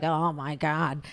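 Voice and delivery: low, slow fed-up sigh of a line; exasperated groan. Retold or imitated. Sister complaining a sibling missed Thanksgiving, exasperated. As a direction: drag it out, weary.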